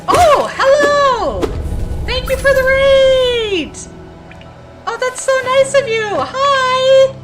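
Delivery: very high, loud excited greeting; cute anime streamer. Real, not acted. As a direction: squeal hello to the raiders.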